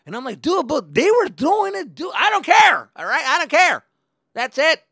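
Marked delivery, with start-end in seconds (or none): 0.4-2.1 high, loud whine; indignant protest. Retold or imitated. Kid protests to driver. 2.1-4.8 high, shouted brush-off; angry dismissal. Retold or imitated. Driver cuts off the protest.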